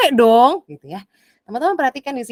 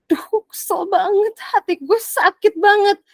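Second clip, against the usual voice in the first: higher, slow, hammed-up hurt complaint; mock whining; 8 semitones higher, slower than usual. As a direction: whine about the hurt, slightly hammed up.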